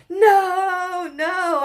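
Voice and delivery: high, loud, tearful protest; retold crying no. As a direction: wail it like you're upset. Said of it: "I quit after a bunch of".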